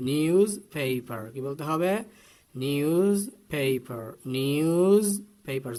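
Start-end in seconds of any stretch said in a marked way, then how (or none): 0.1-1.2 loud "নিউজ paper" with a buzzing z; firm model "নিউজ paper"; z pronunciation. Shown as a demonstration. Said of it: "বর্গীয় জ আসবে". 2.6-5.7 loud, stretched "নিউজ paper" with a long buzzing z; forceful teacher's "নিউজ" ending in z; z pronunciation. Shown as a demonstration. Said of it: "জ বলতে হবে, not স".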